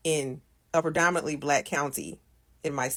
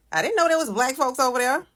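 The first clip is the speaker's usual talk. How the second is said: high-pitched mock disbelief; playful surprise, pitched well up; joking surprise; 8 semitones higher, 3 dB louder than usual. Shown as a demonstration. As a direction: say it as a high, non-literal quip. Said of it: "saying in a joking manner".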